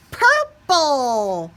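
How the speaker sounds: high, loud, delighted reveal; ta-da the new colour; excited reveal. A narrator's reading.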